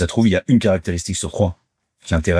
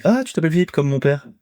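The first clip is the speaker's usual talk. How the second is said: higher-pitched mock caller; surprised caller; 8 semitones higher than usual. A stranger reacting to his first name, high mock surprise. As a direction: put on a high voice for a stranger's remark. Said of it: "une personne sur deux me dit".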